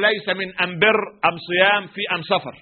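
أم بر، أم صيام، أم سفر with am for al; Himyari dialect. Retold or imitated. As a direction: swap every al for am in أم بر and أم سفر. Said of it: "ولكن لا لام عندنا تنطق". (بلهجة حمير: أم بدل أل في أم بر وأم صيام وأم سفر)

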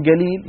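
"قليل" تنطق galiil; القاف g في "قليل"; g for qaf. Shown as a demonstration. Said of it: "بمعنى عظيم وجليل". ("قليل" بصوت g)

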